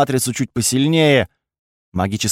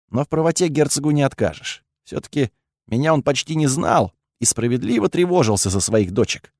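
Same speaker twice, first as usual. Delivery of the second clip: ordinary delivery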